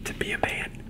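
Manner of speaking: soft, breathy grateful whisper; emotional whisper. Real, not acted. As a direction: whisper it, moved and sincere. Whispered heartfelt thanks to wife.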